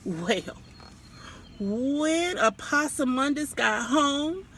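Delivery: usual speaking voice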